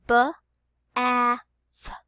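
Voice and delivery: "B, A, th" as three separate sounds; sounded out. Shown as a demonstration. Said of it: "let's do it together".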